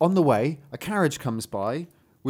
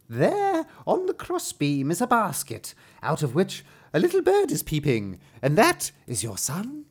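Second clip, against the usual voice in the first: high-pitched opening, then higher storybook character; mysterious dwarf guide; 4 semitones higher than usual. Acted. Dwarf showing a father where his son is, guiding character voice. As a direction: point out the secret as the dwarf. Dwarf directs Hans to the basket.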